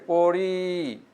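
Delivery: পড়ি stretched, its final ই held; drawn-out ending. Shown as a demonstration. Teacher showing students how to pronounce it, পড়ি drawn out. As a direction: hold the last vowel of পড়ি long. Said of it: "তোমরা এভাবে টানে উচ্চারণ করবে".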